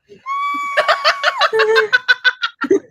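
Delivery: big, high burst of laughter; loud high laugh at the punchline. Real, not acted. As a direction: laugh hard at your own story. After punchline.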